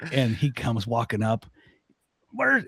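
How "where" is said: put-on angry old man. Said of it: "he goes".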